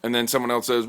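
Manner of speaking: ordinary delivery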